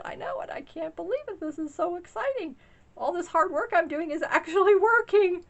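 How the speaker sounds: high and loud, bubbling over with excitement; excited disbelief. Retold or imitated. Telling husband she can't believe it, high excited gush. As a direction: gush that the hard work is paying off. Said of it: "And I said".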